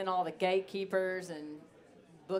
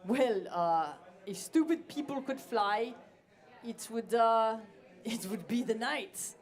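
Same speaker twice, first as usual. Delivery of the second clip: lightly voiced friend, higher and louder; a hint of Nikolai telling the saying, pitched up; quoting friend; 4 semitones higher, 4 dB louder than usual. Nikolai translating the phrase, lightly imitated higher voice. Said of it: "he said".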